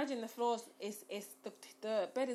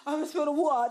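higher, louder, rushed mumble through laughter; mumbling stoned voice; 5 semitones higher, 5 dB louder, faster than usual. Retold or imitated. Sick woman afraid of spilling water, mock mumbling voice. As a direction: imitate a woozy smoking-weed mumble. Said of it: "that mumbling voice".

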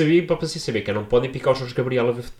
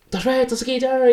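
mock eager greeting; 12 semitones higher than usual.

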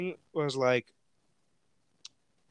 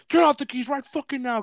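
higher, forceful acted order; urgent command; 10 semitones higher, 4 dB louder than usual. Friend orders the driver to cut the engine, urgent command. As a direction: order it urgently, pitch jumping up.

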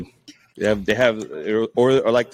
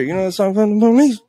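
usual speaking voice

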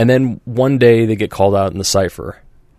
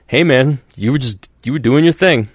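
breezy brush-off, higher and louder; pitched-up, easygoing shrug of a line; imitating someone who doesn't want to deal with it; mock dismissive; 5 semitones higher, 4 dB louder than usual. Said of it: "my favorite one that I've heard".

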